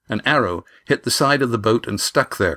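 usual speaking voice

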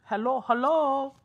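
high, slow put-on German hello; hammed-up German accent; mock German hello. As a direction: stretch it out like a German. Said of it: "Is that how you say it in German?".

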